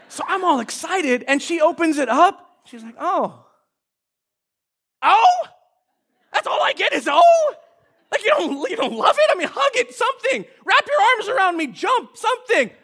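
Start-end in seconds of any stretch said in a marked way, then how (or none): 3.0-3.5 small, restrained "Oh" of a let-down reaction; underwhelmed. Retold or imitated. Daughter's let-down reaction to gift. 5.0-5.5 high, loud, disbelieving "Oh?"; incredulous echo. Retold or imitated. Dad's incredulous echo of her reaction. 6.3-12.7 high-pitched comic indignation; exasperated disbelief. Retold or imitated. Dad acts out exasperation at lukewarm reaction.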